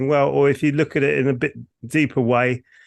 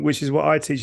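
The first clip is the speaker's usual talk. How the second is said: ordinary talk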